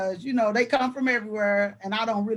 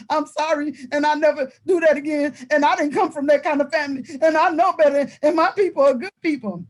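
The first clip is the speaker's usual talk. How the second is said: higher, louder recited apology, item after item; imitated contrite boy; 5 semitones higher, 5 dB louder than usual. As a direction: play a scolded kid reeling off an apology. Retold boy's recited apology to teacher.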